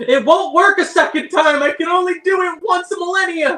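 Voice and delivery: very high, frantic protest; exasperated shouting. Acted. Healer refusing to use his spell twice, exasperated high shout. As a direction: shout it high in comic desperation. Player protests the peeing suggestion in character.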